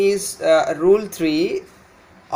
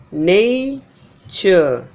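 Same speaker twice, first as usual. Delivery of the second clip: nature split slowly, NAY...chuh; first-syllable stress. Shown as a demonstration. Teacher breaking up the noun, nature stressed on NA. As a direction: draw out NAY in nature, then drop on ture. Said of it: "This is how the primary stress on the first".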